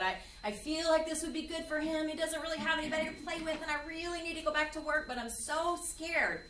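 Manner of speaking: high, fast, worried plea; anxious mom. Retold or imitated. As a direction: voice the frantic mom's worry. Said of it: "in a near panic mode".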